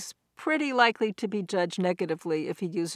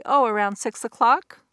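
louder, with "o'clock" swinging up like a question; uptalk; 3 semitones higher, 6 dB louder than usual. Shown as a demonstration. Woman answering when dinner is, rising uptalk on "o'clock". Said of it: "the rising intonation".

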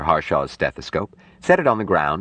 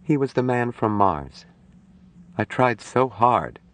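higher woman's voice, drained of expression; flat, drained; 7 semitones higher than usual. Acted. Nurse answers about her dead patient, flat voice. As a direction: answer numbly, slowing at the end. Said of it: "she answered in a flat voice".

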